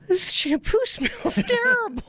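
high-pitched imitation of disgusted complaint; mock outrage. Retold outburst of shampoo victim.

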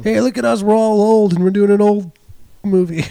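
high, loud mock enthusiasm; mocking impression of actors. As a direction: parody self-satisfied actors pitching their movie. Mocking old actors' movies.

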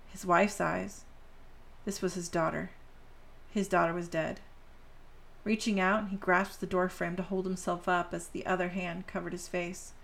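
plain reading voice

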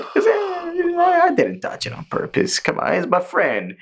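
high, squeaky mocking voice; high-pitched imitation of a smug demon. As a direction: mimic a whiny demon. Mimicking upper rank two.

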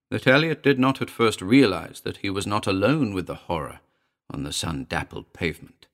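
ordinary delivery, nothing marked

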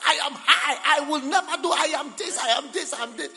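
high, very loud mock bragging; boastful shouting. Preacher mimicking the proud who say never, boastful shout.

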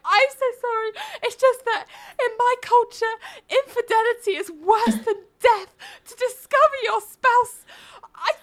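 very high, hammed-up tearful squeal; mock sobbing. Lawyer apologising to the judge, unconvincing mock sobbing. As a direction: plead through badly faked sobs. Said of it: "just full on starts sobbing".

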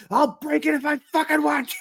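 high strained shout of defiance; defiant retort. Retold or imitated. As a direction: snap back to the wife, pitch jumping way up. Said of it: "I'm like".

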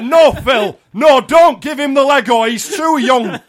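high, shouted northern mum voice. Acted. Mum stopping Phil giving the child Lego, loud northern protest. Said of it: "this would be my mum".

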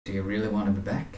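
soft, hesitant acted tearfulness; low-key, choked-up question; fighting back tears. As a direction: ask it quietly, holding back tears. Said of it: "He's sort of fighting back the tears".

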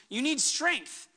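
ordinary talk

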